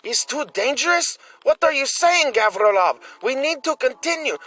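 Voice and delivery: put-on an eager young fighter refusing to retreat; young man pleading. Young man argues to continue.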